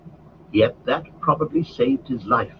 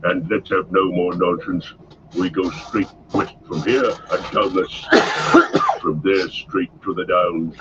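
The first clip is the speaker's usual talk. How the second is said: Ugluk ordering; 4 semitones lower than usual. A narrator's reading. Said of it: "shouted Ugluk".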